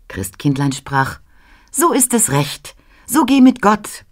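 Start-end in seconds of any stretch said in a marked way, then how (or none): 1.7-4.1 put-on high-pitched Christ Child giving a blessing. A narrator's reading. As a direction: pitch it well up, like a heavenly child. Christkind answers Ruprecht in the poem. (hohe Christkindstimme)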